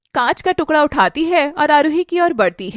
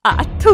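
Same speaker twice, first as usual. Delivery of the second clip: spitting noise; 3 semitones higher, much slower than usual. Acted. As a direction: hawk and spit in contempt. Spitting on Aarohi.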